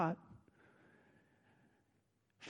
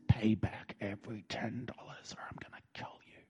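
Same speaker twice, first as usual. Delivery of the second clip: low whispered threat; menacing whisper; 5 semitones lower, 7 dB quieter than usual. Acted. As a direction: whisper it like you have him by the throat. Acting ruler grabbing servant by throat.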